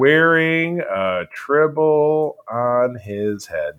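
very slow, every word drawn out; mock title announcement. Host naming the new episode, slow mock-grand announcement.

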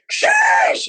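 very high drawn-out shouted sheesh; mock viewer shout. Mocking viewers who yell the term, very high shout. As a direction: squeal it like a hyped chat. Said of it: "It sounds like a mating call".

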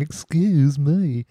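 drawn-out mocking imitation with a laugh; Colin Firth. Host mocking The King's Speech, exaggerated imitation. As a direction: stretch "excuse me" slowly, laughing. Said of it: "Colin Firth just kept going".

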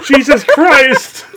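ordinary talk

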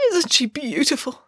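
high doting gush; adoring. Acted. Nanny Piggins cooing at her cake, high adoration. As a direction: coo over the cake lovingly. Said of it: "said Nanny Piggins".

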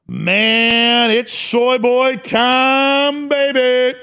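high, stretched-out showman's yell; drawn-out hollering. Acted. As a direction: stretch every word into a long rising holler. Breaks into drawn-out mock yell.

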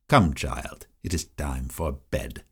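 stern grande dame. Retold or imitated. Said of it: "I will attempt to voice the words of Missus Drew".

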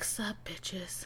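breathy whispered greeting; whispering. Pretend. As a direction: whisper it like a secret hello. Video will greeting, whispered.

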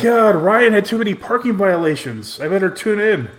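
high, loud, hammed-up fan excitement; mock avid viewer. Co-host mocking people who watch the show, overexcited fan voice.

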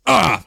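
sharp mock "Ah!" of surprise; startled yell. Retelling a friend's startled yelp behind his mask. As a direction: yelp as the spit hits the mask.

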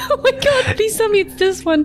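laughing. Real, not acted. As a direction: squeal through laughter. Laughing at the snake picture.

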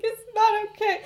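hammed-up anguished denial; half-laughing anguished cry; mock despairing outburst. Remus despairing.

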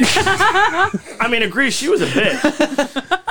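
high, slow channeled spirit voice; seance medium. Pretend. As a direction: speak as a possessed Norwegian spirit. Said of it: "I'm channeling the spirit of a Norwegian".

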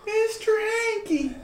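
very high, slow falsetto; tiny squeaky cartoon voice; Mister Hanky. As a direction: stretch the name out in a high squeal. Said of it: "I wanna be Arnold, but Mister Hanky".

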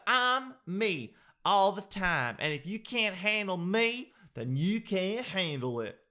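mock defiance. Host mimics people who refuse to adapt, mock-defiant. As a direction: put on a high, stubborn self-righteous voice.

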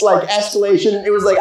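ordinary delivery

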